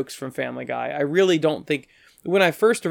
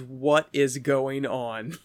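very slow, drawn-out question; understated slow bafflement; baffled; 4 dB quieter, much slower than usual. Retold or imitated. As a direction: imitate a friend's quiet disbelief. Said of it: "Josh goes".

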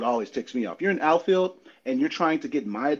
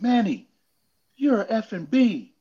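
heckling Manny; 9 semitones higher, 4 dB louder than usual. Retold or imitated. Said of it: "some dude's like".

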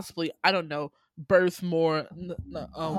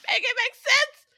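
squealed plea; 7 dB louder than usual. Real, not acted. Host squealing the plea to her friends, exasperated. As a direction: Make it make sense squeaked much higher. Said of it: "repeated, much higher".

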